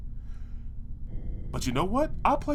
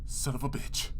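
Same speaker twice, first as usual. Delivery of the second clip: whispered oath under the breath; whispered curse; 6 semitones lower, 9 dB quieter than usual. Acted. Realises door is locked.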